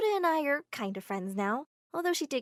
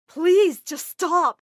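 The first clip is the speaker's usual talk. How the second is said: pleading shout; 7 semitones higher, 13 dB louder, much slower than usual. Acted. Armitage begs Mama.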